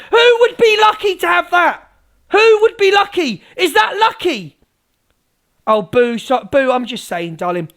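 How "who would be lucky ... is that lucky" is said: high, loud incredulous demand; ranting shout. Pretend. Streamer staging a rant for viewers, shouting indignantly. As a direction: shout it as outraged questions. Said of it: "start screaming and shouting".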